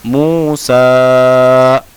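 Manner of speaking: मूसा chanted with both vowels held long; elongated recitation. Shown as a demonstration. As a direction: stretch सा of मूसा, loud and steady. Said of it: "पढ़ा कैसे जाएगा?".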